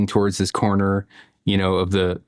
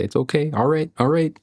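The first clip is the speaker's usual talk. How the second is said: high, hammed-up soothing as if facing a wild animal; mock surrender; 8 semitones higher than usual. Father recalling his hammed-up wary surrender to his angry son. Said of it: "I'll raise my hands in defeat".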